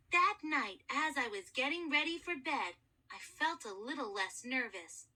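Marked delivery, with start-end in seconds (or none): none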